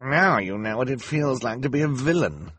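lightly chuckling henchman; chuckling bald man. A narrator's reading. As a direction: gloat with a touch of amusement. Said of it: "the bald man chuckled".

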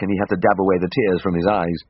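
ordinary delivery, nothing marked

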